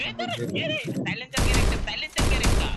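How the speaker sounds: loud, high excited callout; excited shout. Real, not acted. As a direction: yell it out mid-fight. Excited callout mid fight.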